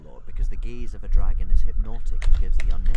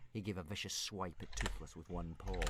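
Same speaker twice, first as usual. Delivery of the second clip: ordinary delivery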